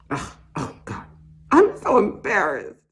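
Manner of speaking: flustered woman begging through a mask; embarrassed shopper. Acted. Masked shopper pleading with the clerk, flustered and mortified. Acts shopper in store monologue.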